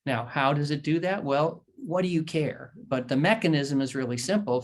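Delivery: ordinary delivery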